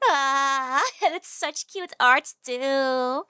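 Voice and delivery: drawn-out playful wail; loud, slow mock despair; whiny lament. Real, not acted. Lamenting broken standee.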